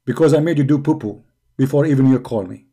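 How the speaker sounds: ordinary talk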